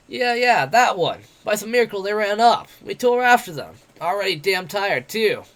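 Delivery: brash, jeering tavern boaster; cocky werewolf adventurer. Acted. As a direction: taunt him like a swaggering bully. Bete voiced in the story.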